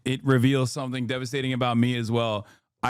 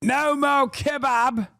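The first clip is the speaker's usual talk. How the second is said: hammed-up chant, much higher, slow and shouted; mock rally yell; 9 semitones higher, slower than usual. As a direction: yell it like a parody of a protester. Mock rally yelling about food.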